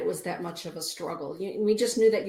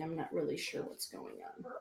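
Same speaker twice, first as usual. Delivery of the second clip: normal talking voice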